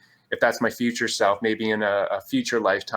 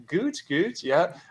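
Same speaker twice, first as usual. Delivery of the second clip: unsure German reply; 6 semitones higher, slower than usual. Retold or imitated. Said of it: "I'm like".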